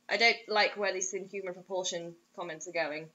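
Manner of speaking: usual speaking voice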